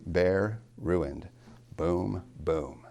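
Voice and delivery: bare, ruined drawn out, then boom, boom evenly weighted; slow, separate beats on bare, ruined, boom, boom; two equal stresses. Shown as a demonstration. Teacher shows a spondee, two equal stresses. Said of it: "Two stressed immediately at the beginning".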